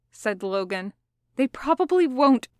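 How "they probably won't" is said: raised pitch, cut off by a choke; strained, unable to finish the lie; choking on words. A narrator's reading. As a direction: force it out, then choke on it. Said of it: "Logan choked on the words".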